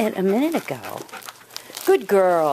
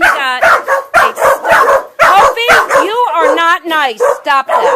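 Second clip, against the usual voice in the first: louder, higher telling off, "Hopi" drawn out slowly; loud scolding; 10 semitones higher, 10 dB louder, slower than usual. Real, not acted. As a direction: scold the dog firmly. Scolding dogs over stuffed toys.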